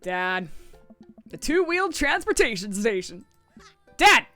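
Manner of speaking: fighting game announcer hype; cheesy announcer voice. Acted. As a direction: oversell the house like a TV announcer. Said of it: "do my best cheesy announcer voice".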